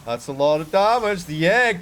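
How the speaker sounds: fast, rising caster hype through the fight; excited esports caster calling a clash; play-by-play. Acted. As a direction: call the fight live as it unfolds. Live casting a team fight.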